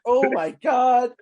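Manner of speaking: slow, loud, high-pitched disbelief; exaggerated appalled reaction; mock shock. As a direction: draw it out, aghast and amused. Amazed reaction to retold scene.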